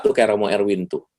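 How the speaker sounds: ordinary delivery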